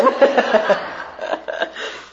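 breathy burst of laughter. Real, not acted. Speaker joking about having contacts in, laughing. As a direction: laugh at your own remark. Laughs at her own new-person remark.

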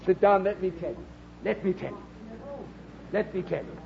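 hushed, falling repeats of an invitation; quiet confiding invitation. Retold or imitated. Preacher re-enacting his answer to a stranger, quietly confiding. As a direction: softly draw the listener in. Acts out his reply to questioner.

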